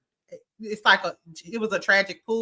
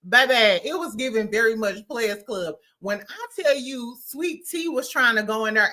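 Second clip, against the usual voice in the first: ordinary talk, nothing marked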